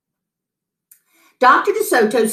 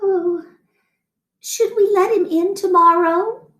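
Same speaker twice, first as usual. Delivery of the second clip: much higher, anxious wife voice; worried wife wondering; 11 semitones higher than usual. A narrator's reading. Wife lying awake asking husband, higher and anxious. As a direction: fret aloud in a small high voice. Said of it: "Missus DeSoto wondered".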